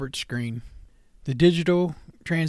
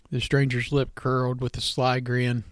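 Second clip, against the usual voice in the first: plain reading voice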